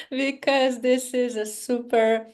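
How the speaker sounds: giggling nervously through the word; nervous laugh. Real, not acted. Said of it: "It's a nervous laugh".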